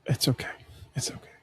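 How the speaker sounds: hushed, soft reassurance; quietly consoling; reassuring. Real, not acted. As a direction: soothe them almost in a whisper. Soothing an apologising co-host.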